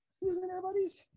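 sweet high voice for the animals; high pet-talk voice. Real, not acted. Repeat to tortoises, higher pitch.

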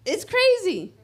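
real laughing disbelief. Real, not acted. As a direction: laugh it out in amazement. Laughing at the text.

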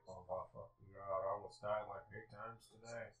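soft, low, drained voice; hushed, worn-out mock disbelief; mock shaken muttering. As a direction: mutter it under your breath, as if exhausted. Voicing a shaken film character.

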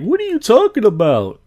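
high, loud mock protest; put-on high-pitched indignant dude; defensive funny voice. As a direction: imitate a friend getting squeaky when called out. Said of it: "a funny voice in this episode".

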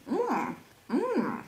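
chewing while humming; closed mouth, food in cheeks; mouth full. Real, not acted. Seen 0: Cheeks puffed, lips closed, chewing the stick while humming approval.

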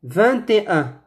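Vingt et un with the t linked into et; « et » pronounced. Shown as a demonstration. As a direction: contrast model, Vingt et un as vin-té-un. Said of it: "On dit". (Vingt et un, avec « et » et t prononcé)

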